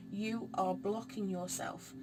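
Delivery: ordinary talk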